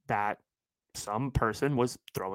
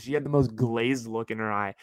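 ordinary delivery

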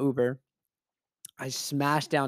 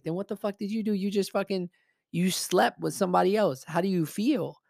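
heated, higher-pitched retort; indignant challenge; 6 semitones higher than usual. Retold or imitated. Friend challenges cheating groom after the hookup, indignant. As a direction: confront him in a raised pitch.

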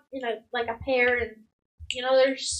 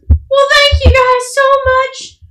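loud, high, sing-song motherly thanks; singsong hearty mother; grateful mother; 9 semitones higher, 10 dB louder than usual. Acted. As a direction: gush with gratitude in a big mom voice. GM voices grateful hunky mother.

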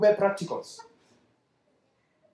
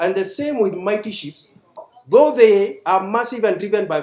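loud, emphatic preaching read; scripture reading; 3 semitones higher, 6 dB louder than usual. Acted. Preacher reads James to the congregation, forceful. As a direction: preach the verses at full force.

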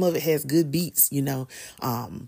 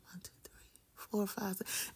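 soft whispered tally, fading away; whispered counting; 19 dB quieter than usual. Acted. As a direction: count them off under your breath. Counting friends under breath.

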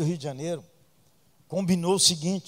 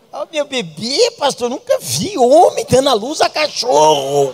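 put-on loud, high cooing baby talk; loud, high mock baby talk; doting dog owner; 6 semitones higher, 11 dB louder than usual. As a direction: coo over a pet like a baby. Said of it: "as mulheres chamam o cachorro de neném".

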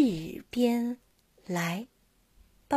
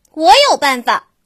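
eager call; 2 semitones higher, 10 dB louder, faster than usual. Acted. Monkey calls out idea.

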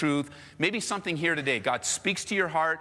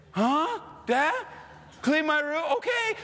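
put-on higher innocent kid voice; playing dumb kid; 11 semitones higher than usual. Kid pretending he never heard dad, higher innocent voice. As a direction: feign surprise at the request.

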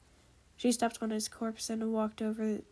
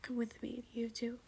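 much softer, firm order; quiet command; 10 dB quieter than usual. A narrator's reading. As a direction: quietly beckon them.

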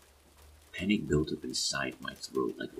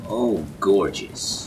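loud, drawn-out mock admiration; higher, exaggerated sarcastic praise; 5 semitones higher, 9 dB louder, much slower than usual. A narrator's reading. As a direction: gush it slowly, dripping with sarcasm. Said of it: "Aaron said sarcastically".

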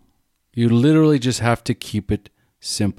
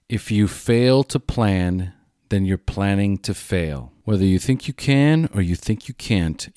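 normal talking voice

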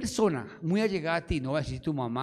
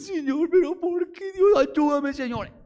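much higher and louder anguished crying out; agonizing prayer; 11 semitones higher, 8 dB louder than usual. Shown as a demonstration. Preacher showing how to pray in a crisis, anguished cry. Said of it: "Una oración agónica".

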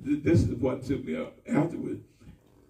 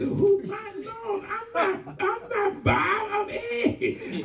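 mock protest; much slower than usual. Retorting to the shouting churchgoer.

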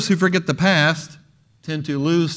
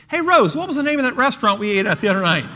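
higher, quick mock call to a wife; 7 semitones higher, 4 dB louder, faster than usual. Forgetful husband calling to his wife Rose, retold call-out. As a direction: rattle off the punchline as a called question.

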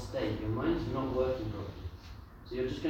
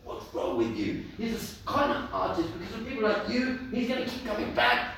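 angry rant; 9 semitones higher, 4 dB louder than usual. Retold or imitated. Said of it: "he kept doing it".

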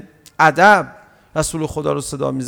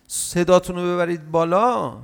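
normal talking voice

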